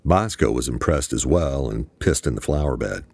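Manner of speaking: usual speaking voice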